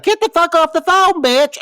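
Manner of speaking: very high mock wail; imitating a frantic, high-pitched outburst; Gretchen impression, yelling. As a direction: yell down the phone in a hammed-up high voice.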